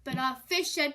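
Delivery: ordinary delivery, nothing marked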